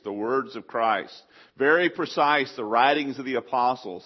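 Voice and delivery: ordinary delivery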